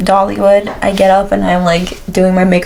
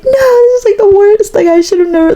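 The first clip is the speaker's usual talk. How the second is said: put-on drunk crying; 11 semitones higher than usual. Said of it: "I'm crying home".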